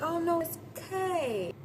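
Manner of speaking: high, cooing mock comfort; imitated soothing. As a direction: coo it like comforting someone in tears. Acting him comforting crying woman.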